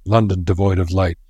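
ordinary delivery, nothing marked

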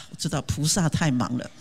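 ordinary talk, nothing marked

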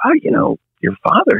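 lightly voiced eager father; eager dad. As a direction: sell it like a keen dad. Dad voice offering help to son.

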